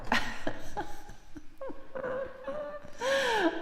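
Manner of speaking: high breathy laugh that nearly cries; long laugh. Real, not acted. Streamer laughing helplessly about accents, squeaky laugh.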